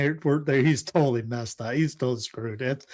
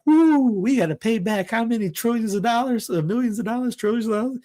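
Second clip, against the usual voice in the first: excited disbelief; 9 semitones higher than usual. Real, not acted. Alarm over paying back tariffs.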